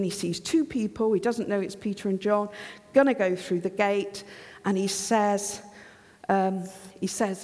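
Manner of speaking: normal talking voice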